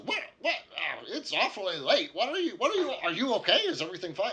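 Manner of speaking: put-on high, loud flustered old man voice; fussy startled old wizard; sleepy old wizard. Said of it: "he just says".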